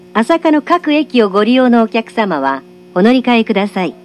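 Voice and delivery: ordinary talk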